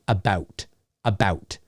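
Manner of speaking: short Canadian vowel in about. Shown as a demonstration. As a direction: say about as a-BUH-oot, quietly. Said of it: "generally, you'll hear more of a".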